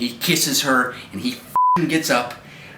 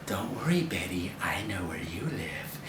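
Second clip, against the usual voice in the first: low, breathy killer's whisper; creepy whisper; 8 semitones lower, 14 dB quieter than usual. Retold or imitated. The unseen killer threatens Betty, in a creepy low whisper. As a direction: whisper it like a stalker in the dark. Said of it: "you hear the voice".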